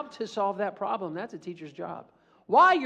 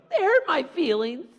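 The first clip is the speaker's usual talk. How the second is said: whiny complaint; 9 semitones higher, 4 dB louder than usual. Acted. Whining to the judge.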